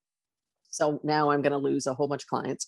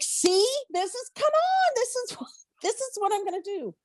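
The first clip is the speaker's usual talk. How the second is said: much higher, squeaky pleading; pleading protest. Retold or imitated. Holding the contract, she pleads with God in a squeaky high voice. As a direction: squeal it way up, begging. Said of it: "And I'm like".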